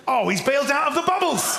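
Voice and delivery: loud playful dismay; dismayed outcry. Real, not acted. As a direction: cry out as hope vanishes. Cries out as typing bubbles vanish.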